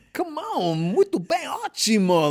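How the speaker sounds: festive Brazilian party invite. Retold or imitated. Imitating Brazilians welcoming someone to a party, high and festive. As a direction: invite them in like it's a party. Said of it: "a feeling of, like".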